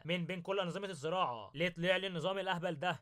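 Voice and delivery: ordinary delivery, nothing marked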